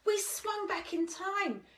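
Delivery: put-on a child explaining in a rush; Danny's voice. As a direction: pitch up as young Danny. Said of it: "said Danny to Dinos A".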